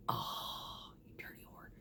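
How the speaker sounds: hushed whispered insult; whispered curse. Retold or imitated. Driver cursing her truck, whispered aside. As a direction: breathe the curse out under your breath.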